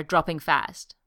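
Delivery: ordinary delivery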